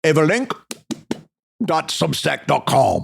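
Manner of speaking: muddled French-ish accent, halting phrases; badly done French accent; put-on French accent. Shown as a demonstration. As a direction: put on a dubious French accent, pausing after the first words. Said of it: "say that with an accent".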